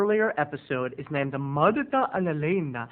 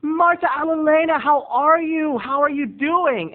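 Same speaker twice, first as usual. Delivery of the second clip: high, loud, gushing hello; squealed, eager greeting; excited greeting; 11 semitones higher, 6 dB louder than usual. Retold or imitated. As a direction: re-enact an over-excited reunion. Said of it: "I was so excited".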